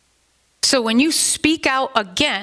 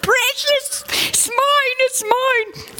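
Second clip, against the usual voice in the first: high, hissing creature voice clutching the ring; Gollum voice; 14 semitones higher, slower than usual. Retold or imitated. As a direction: squeak it out like Gollum guarding his treasure. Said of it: "if I had the Gollum voice".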